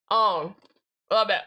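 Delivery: ordinary talk, nothing marked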